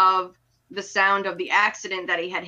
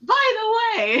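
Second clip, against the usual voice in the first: much higher, chirpy voice; mock ditzy girl; 13 semitones higher than usual. Mocking the heroine's chatter, high ditzy voice. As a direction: toss it off brightly, pitched up. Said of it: "she's like".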